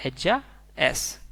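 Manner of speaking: एज्जा एस said slowly and deliberately; slow it down for the class; slow model repeat. Shown as a demonstration. Teacher repeating Spanish she is slowly for learners. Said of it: "तो हम इसे बोलेंगे".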